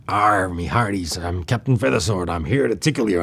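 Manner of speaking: piratey parody voice; Captain Feathersword. Retold or imitated. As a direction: growl it out like a jokey pirate captain. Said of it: "kind of a, a piratey".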